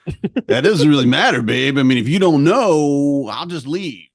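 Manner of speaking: hammed-up husband deflecting; mock bluster. Husband dodging wife's question, blustering. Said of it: "I'm like".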